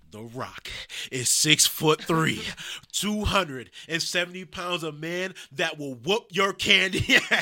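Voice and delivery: booming, slow promo shout; The Rock. Retold or imitated. Fan re-enacting the feud promo, going hard and loud. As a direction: belt out each phrase slowly, like a wrestler's threat. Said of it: "He was going hard".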